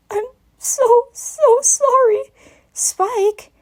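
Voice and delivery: put-on high, sobbing child's voice; tearful cracking plea; crying. A narrator's reading. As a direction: weep through the apology. Dragon crying in her arms.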